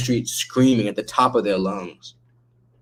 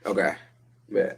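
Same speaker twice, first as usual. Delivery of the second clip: ordinary delivery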